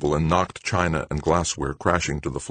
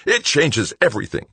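sharp, louder, higher retort; heated snap; snapped; 8 semitones higher, 5 dB louder than usual. A narrator's reading. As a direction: cut back angrily. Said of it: "he snapped".

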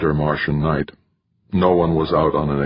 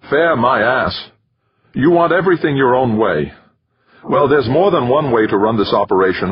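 higher, louder, rising into a heated rant; angry outburst; 7 semitones higher, 3 dB louder than usual. A narrator's reading. Dex rants at Jamie over his stubbornness, loud and angry. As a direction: lash out, words tumbling faster as anger builds.

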